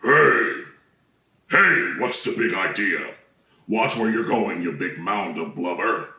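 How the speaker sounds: deep, slow, low voice. Acted. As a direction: rumble it from the bottom of the range. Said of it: "Let me try number ten".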